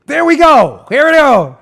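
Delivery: loud, high there we go; loud gleeful here we go; triumphant. Real, not acted. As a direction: announce it like a win. Triumphant find.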